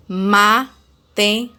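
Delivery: matemática split as ma, te, má, ti, ca; long pauses between syllables of matemática; five separate beats on matemática. Shown as a demonstration. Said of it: "Podemos dividir as palavras em sílabas".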